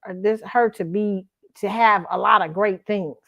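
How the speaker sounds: ordinary talk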